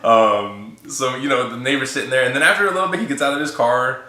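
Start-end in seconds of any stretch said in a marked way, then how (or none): none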